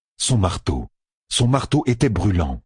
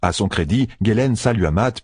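usual speaking voice

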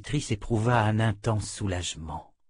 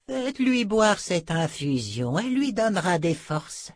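healer's voice; 8 semitones higher than usual. Acted. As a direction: give careful instructions as the healer. (guérisseuse à la voix plus aiguë)

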